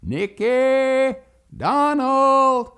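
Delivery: loud, high, long-held calls; calling out. Retold or imitated. Daddy calls for his lost boys, hollering. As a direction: holler the names across the yards. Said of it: "he began to call us".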